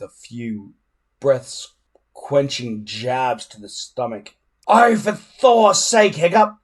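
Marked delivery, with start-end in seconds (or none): 4.7-6.6 high, loud, slow fed-up shout; a coach losing patience; exasperated yell. A narrator's reading. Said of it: "yelled Gubba in exasperation".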